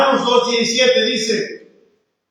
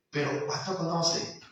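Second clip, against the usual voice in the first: hushed question; 6 semitones lower, 14 dB quieter than usual. Retold or imitated. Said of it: "Yo digo".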